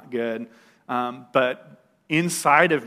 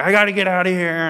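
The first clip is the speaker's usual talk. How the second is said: frantic; 6 semitones higher, 6 dB louder, faster than usual. Retold or imitated. Wild patient wants to leave.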